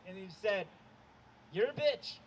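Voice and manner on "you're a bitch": imitated heckle from a window; higher, louder mock shout; retold yell. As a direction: holler it down like a stranger jeering. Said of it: "yelled down at me".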